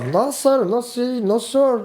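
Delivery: high, insistent no sir; mock refusal. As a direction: say it high and stubborn, like a man refusing over and over. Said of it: "He's all".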